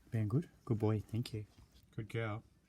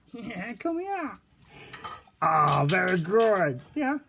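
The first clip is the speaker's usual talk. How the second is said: excited loud praise; 3 semitones higher, 16 dB louder, slower than usual. Real, not acted.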